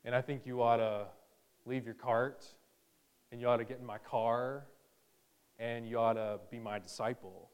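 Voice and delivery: a hint of a creepy stranger, low and hushed; random stranger's pitch. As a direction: play a calm stranger asking you to follow him. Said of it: "randomly and saying".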